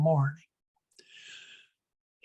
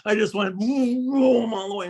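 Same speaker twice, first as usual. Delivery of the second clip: mock engine vroom; 6 semitones higher than usual. Imitates speeding off.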